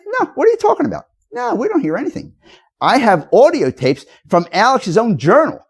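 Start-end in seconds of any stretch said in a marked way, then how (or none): 0.1-2.3 much higher, mocking kid voice; mock kids' denial. Ranting speaker mimics the kids telling investigators nothing happened, high mock voice.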